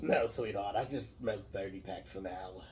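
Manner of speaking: low, hammed-up Jersey accent; put-on low Jersey smoker voice; New Jersey smoker. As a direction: play a chain-smoking Jersey sweetheart. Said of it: "throat cancer or something".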